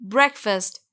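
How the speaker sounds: ब्रेकफास्ट said "BREK-fust"; correct pronunciation. Shown as a demonstration. Teacher has learners repeat it, clearly pronounced. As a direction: say ब्रेकफास्ट with short e and weak "fust". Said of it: "फिर से बोलिए".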